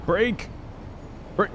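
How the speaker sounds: high, urgent cry to stop; urgent shout. Real, not acted. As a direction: yell for the train to brake. Train won't stop in time.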